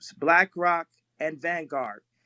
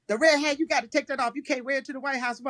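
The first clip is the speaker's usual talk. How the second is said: lightly voiced wife, higher pitch; imitated wife; 6 semitones higher, faster than usual. Husband retelling his wife's order about the red hat, lightly imitated wife. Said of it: "then Kim comes to me".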